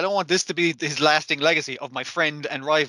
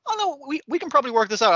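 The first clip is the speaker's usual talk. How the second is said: much higher, sweet giggly voice; smitten mother; 11 semitones higher, faster than usual. Retold or imitated. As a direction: gush like a woman talked into love by a film. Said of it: "I was just like".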